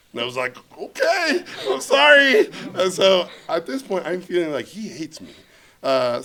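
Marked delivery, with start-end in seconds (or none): 0.8-2.5 very high, loud mock apology; flustered apology. Guest apologizing to the offended host, high-pitched and flustered. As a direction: squeal a cowed apology, hammed up.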